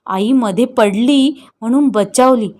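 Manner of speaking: plain reading voice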